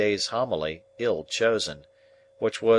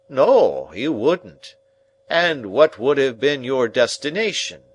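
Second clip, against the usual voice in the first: loud, higher, slow pompous uncle; 3 semitones higher, 6 dB louder than usual. Acted. Pompous uncle lecturing the dinner guests, loud and slow. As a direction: boom out pompous table wisdom.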